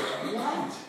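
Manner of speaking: high, breathy startled what; shocked what. Retold or imitated. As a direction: react like the news just hit. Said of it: "Elijah goes".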